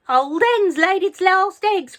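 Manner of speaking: high, loud, slow cackling jeer; shouted. A narrator's reading. Grandma shouting down about the hen, loud and slow. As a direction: crow it down from the roof. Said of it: "Grandma shouted".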